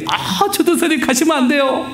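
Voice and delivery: high, laughing mock plea of the young people; a light imitation of begging students; pleading youth. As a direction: whine like the kids begging him to stay. Youth group pleading, voiced.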